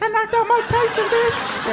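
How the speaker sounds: very high, gloating yell; older brother bragging; triumphant boast. Retold or imitated. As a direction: crow over the win.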